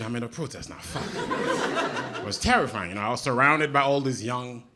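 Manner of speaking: ordinary talk, nothing marked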